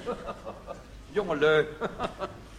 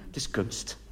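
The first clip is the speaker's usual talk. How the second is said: hushed; 2 semitones lower, 7 dB quieter, slower than usual. Acted. Embarrassed correction, hushed.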